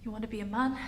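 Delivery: soft, resigned question with rising end; subdued question. Acted. Character facing partner after confession, subdued question. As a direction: ask it gently, giving in. Acted softer return to challenge.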